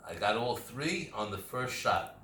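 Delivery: sudden loud delighted whoa; surprised whoa. Real, not acted. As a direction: burst out in pleased surprise. Surprised by his breathing-game result.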